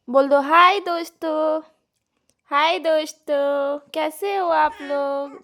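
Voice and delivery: put-on high, loud, slow sing-song coaxing the baby to say hi; baby talk. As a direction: stretch each word out for a toddler to copy. Prompting baby to greet viewers. (बच्ची को सिखाते हुए ऊँची, धीमी तोतली आवाज़)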